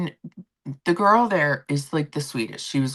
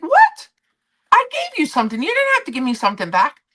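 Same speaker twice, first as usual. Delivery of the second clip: much higher and louder, squealing in surprise; shocked disbelief; 10 semitones higher, 4 dB louder than usual. Retold or imitated. Vlogger reliving her shock when the bartender gave a gift back, much higher and louder. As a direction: yelp the "What?" way up, then spill the rest fast.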